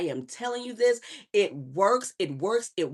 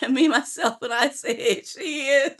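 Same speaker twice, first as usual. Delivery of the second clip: loud, amused words through laughter; real laughter in the voice; laughing; 5 semitones higher, 5 dB louder than usual. Real, not acted. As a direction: talk while cracking up. Laughing while reading the chat.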